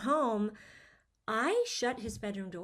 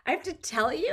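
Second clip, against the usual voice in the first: mock teen attitude; 6 semitones higher than usual. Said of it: "his attitude was like".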